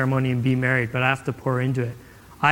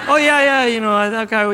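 high, loud opening that sinks; mock eager bluffing; 6 semitones higher, 4 dB louder than usual. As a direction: bluff your way through the quiz. Bluffing through wife's movie quiz.

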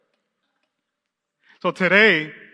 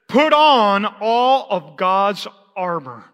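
"Put on all of God's armor" drawn out slowly, each word weighted; slow scripture; 4 dB louder, much slower than usual. Shown as a demonstration. Preacher reading Paul to the congregation, slow and weighty. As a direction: read it slow and solemn as scripture. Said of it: "And he says".

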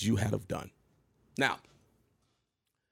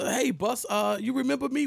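much higher, eager mock greeting; nervous younger self; 12 semitones higher than usual. Greeting [name] in the alley, higher nervous voice. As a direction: play his starstruck younger self. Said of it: "and I t".

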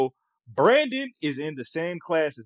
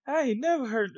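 stunned; 11 semitones higher than usual. Retold or imitated. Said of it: "inner reaction to Brandon".

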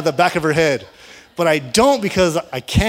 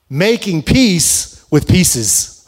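very slow, every word weighed; slow title announcement; 3 dB louder, much slower than usual. Real, not acted. Pastor announcing the sermon title, slow and deliberate. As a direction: let the sermon title land.

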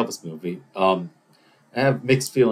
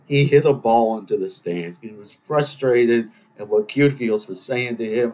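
usual speaking voice

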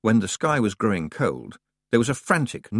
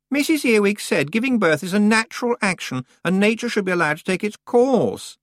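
put-on high, loud, complaining girl's voice; whiny young witch; 12 semitones higher, 4 dB louder than usual. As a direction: whine like a spoiled out-of-depth girl. Anagramma voice.

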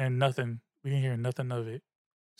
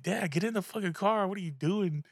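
higher-pitched retold plea to dad; mock alarmed scolding; 6 semitones higher than usual. Telling dad to get back in the car, mock alarmed. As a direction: urge dad back in, lightly acted.

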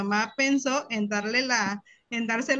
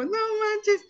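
mock surprise; 10 semitones higher than usual. Sister's surprised reply.

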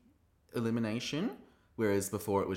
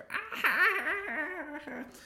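very high, long hammed-up cackle; Rue impression laugh. Host losing it at a queen's joke, imitated high cackle. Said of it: "That's my Rue impression".

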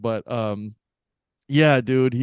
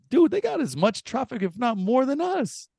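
pitched way up, amazed at the traffic; excited disbelief; 11 semitones higher than usual. Real, not acted. Telling a friend how jammed the roads were, excited. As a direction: marvel at how bad it is.

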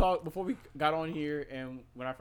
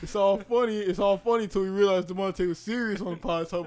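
half-laughing, loud and high; loud amused rant; loud laughing outburst; 6 semitones higher, 10 dB louder than usual. Real, not acted. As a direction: blurt it out through laughter.

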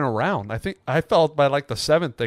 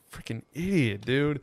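under-breath grumble, much softer; muttered insult; 8 dB quieter than usual. Real, not acted. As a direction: mutter it to yourself. Muttered insult after story.

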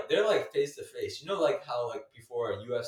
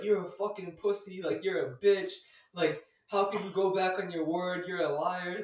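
higher-pitched retold rant; indignant accusation; 6 semitones higher than usual. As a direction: imitate an outraged pool player calling someone out. Acting out the pool player's accusation.

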